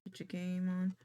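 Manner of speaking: usual speaking voice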